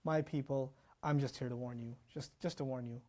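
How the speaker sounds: a prophet gently calling his people after nine centuries, tender and soft; tender plea. Retold or imitated. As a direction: plead softly like a loving prophet. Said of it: "How are you talking like this".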